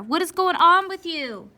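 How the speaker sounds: high, loud exasperated scold; shrieking at your own mistake; scolding myself. Acted. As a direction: yell at yourself for a dumb slip. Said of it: "That's how I feel like inside when I mess up".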